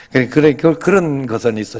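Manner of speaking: normal talking voice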